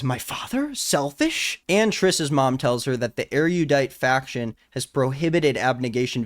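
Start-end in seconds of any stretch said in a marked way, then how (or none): 0.0-1.6 shocked question. Retold or imitated. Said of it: "She says".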